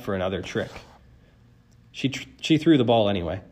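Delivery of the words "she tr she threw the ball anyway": ordinary delivery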